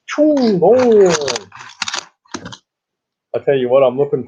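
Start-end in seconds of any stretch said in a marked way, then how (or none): 0.1-1.5 mock "choo, oh" sound, high and a bit louder; imitated whoosh. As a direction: make a quick, high silly noise effect.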